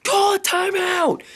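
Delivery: high, shouted plea for a timeout; desperate shout. Retold or imitated. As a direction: beg the coach from the stands. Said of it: "I was like".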